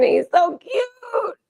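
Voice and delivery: very high, slow squeal with a cry; squealing over cuteness. Real, not acted. Owner gushing at her cat, high slow teary squeal. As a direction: gush like it's unbearably cute.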